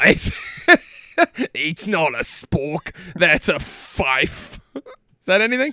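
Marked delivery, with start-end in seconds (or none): 0.0-4.6 slow, lower Aussie drawl through a laugh; laughing Australian. Pretend. Riffing on the movie quote, a slow laughing Australian accent. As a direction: break up mid-line but keep the Aussie voice. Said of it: "tough guy Australian accent".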